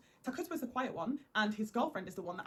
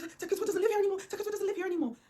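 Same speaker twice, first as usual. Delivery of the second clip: panicked stammer; 10 semitones higher, 4 dB louder than usual. Retold or imitated. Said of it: "And she's like".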